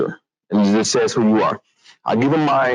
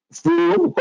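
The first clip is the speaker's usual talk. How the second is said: arrogant. Retold or imitated. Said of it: "I didn't wanna come across as arrogant".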